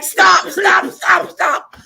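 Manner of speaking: high, loud, urgent yelps; panicked mock shout. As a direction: act out a desperate man begging to halt. Retold man desperate to reach bathroom.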